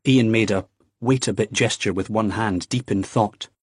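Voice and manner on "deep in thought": usual speaking voice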